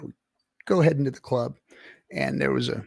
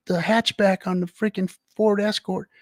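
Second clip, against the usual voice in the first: usual speaking voice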